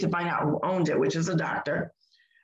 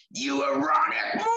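high, strained mock-demon shriek; demon-voiced yell; 7 semitones higher than usual. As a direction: yell it like a possessed, hateful stranger. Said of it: "yelling at me".

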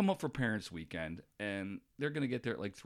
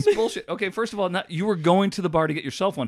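raised, louder objection; loud protest; 7 semitones higher, 10 dB louder than usual. Real, not acted. Host loudly disputes his friend's story, raised voice. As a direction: call it out loudly.

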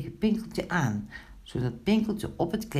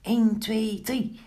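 high, rapid countdown; quick count; 7 semitones higher, slower than usual. A narrator's reading. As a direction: rattle off the numbers up high. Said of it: "telde vlug". (vlug en hoog tellend)

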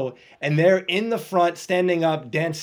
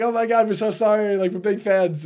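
higher, flustered retold sorry; mock frightened fans; panicked apology; 7 semitones higher, 3 dB louder than usual. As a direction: gush apologies to armed police. Caught intruders apologizing in panic.

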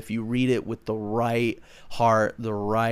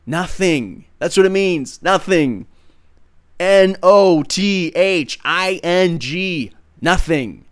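louder and higher, pounding each "Nothing"; emphatic preaching shout; 6 semitones higher, 8 dB louder than usual. Real, not acted. Preacher hammering home the meaning to listeners, shouting. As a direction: spell it out like a rallying cry.

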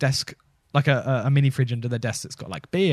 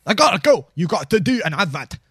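higher, louder Scottish courier voice; chirpy delivery man announcing himself; Michael Mintz; 5 semitones higher, 5 dB louder than usual. Acted. Delivery man announcing the mints, higher Scottish voice. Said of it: "Michael Mintz".

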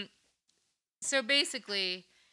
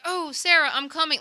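high, louder mock calling out; imitating Huggle; 10 semitones higher, 6 dB louder than usual. Host voicing the friend rushing to Sarah, high mock call. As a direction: call out eagerly in a high put-on voice. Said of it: "Huggle's like".

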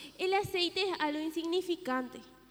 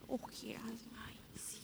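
whispering; 7 semitones lower, 16 dB quieter than usual. Acted. Sleepy girls dozing off.

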